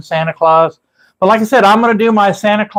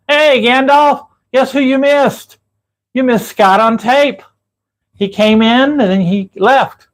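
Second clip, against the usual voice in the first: excited call-out; 8 semitones higher, slower than usual. Real, not acted. Calling out to viewer Gandalf.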